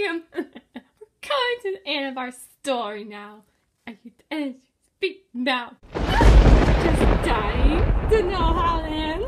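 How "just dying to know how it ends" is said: slow taunting. Acted. Villain's drawn-out taunt.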